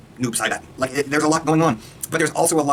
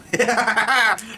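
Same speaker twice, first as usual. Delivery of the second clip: high cackling laugh; 9 semitones higher than usual. Real, not acted. Laughing at own crude joke.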